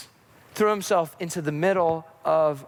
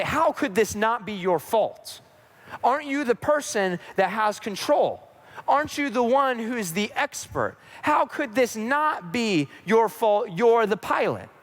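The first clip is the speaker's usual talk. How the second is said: indignant, raised-pitch protest; accusing; 4 semitones higher than usual. Acted. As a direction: challenge the pilot in disbelief. Passenger responds, blaming the pilot.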